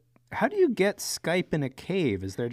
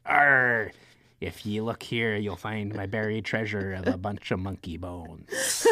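growly storybook pirate; 5 semitones lower than usual. Retold or imitated. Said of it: "a pirate going like".

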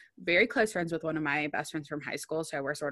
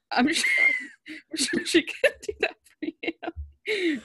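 high, laughing through the words; giggling while answering; 5 dB louder, slower than usual. Real, not acted. Laughing at the Draco request.

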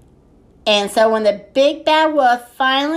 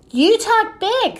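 taunting; 11 semitones higher than usual. A narrator's reading. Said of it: "said Ziggy".

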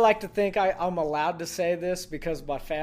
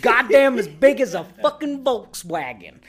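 slow, louder mock East Texas drawl; 5 semitones higher, 7 dB louder than usual. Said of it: "all of my Texas family is East Texas".

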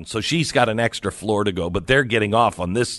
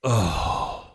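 imitated groan of annoyance; exasperated groan; 4 dB quieter than usual. Woman stepping into the delayed elevator, exasperated groan. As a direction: let out a put-upon "oh". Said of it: "she just does this".

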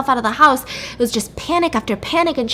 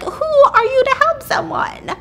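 high-pitched incredulous jab; scoffing high rhetorical question; 13 semitones higher than usual. Real, not acted. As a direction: pitch it way up in disbelief. Mock incredulous question about her.

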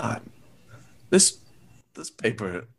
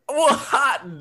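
surprised; 12 semitones higher, 4 dB louder than usual. Acted. Shocked at failing grades.

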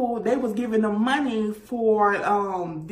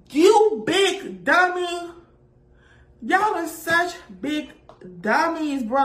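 scolding; 6 semitones higher than usual. Real, not acted. Scolding the players.

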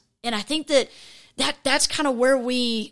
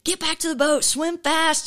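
retold yell; 6 semitones higher than usual. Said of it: "they start yelling at him like".

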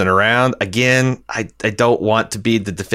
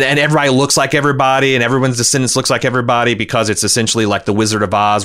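normal talking voice

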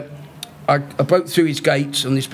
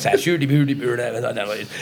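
mock Icelandic gibberish; 4 semitones lower than usual. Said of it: "It's not an impression of him".

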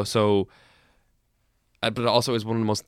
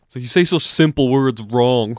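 louder mocking friend's voice; imitating Shane Kenna; 3 semitones higher, 8 dB louder than usual. Friend of the show correcting him, loud mock nagging. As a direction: play the friend who nags him about his words. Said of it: "keeps pointing it out to me".

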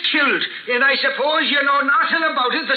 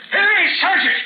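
calling out orders; 5 semitones higher than usual. Acted. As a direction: bark it out to the sergeant in the next room. Inspector calls orders across room.

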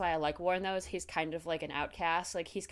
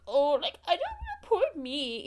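much higher mocking whimper; mock whine; 11 semitones higher, 4 dB louder than usual. [name] mocking Eddie in a much higher whiny voice. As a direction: parody a sulky complainer. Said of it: "sitting over there like".

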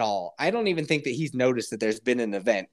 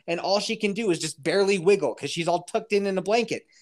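ordinary talk, nothing marked

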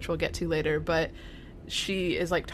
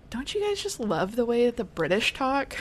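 higher-pitched mock gushing; imitating Phoebe; 6 semitones higher than usual. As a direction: gush it in a high voice. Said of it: "Phoebe is like".

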